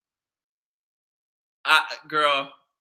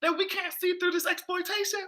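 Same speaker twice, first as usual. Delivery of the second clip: ordinary talk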